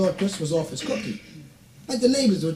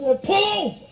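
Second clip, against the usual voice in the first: high squeak; 12 semitones higher than usual. Acted. Chris reacting to land.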